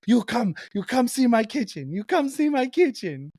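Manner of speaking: very high, excited mock Italian old woman; so happy Italian accent. Happy old Italian cook inviting guest to kitchen, excited Italian accent. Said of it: "she's so happy".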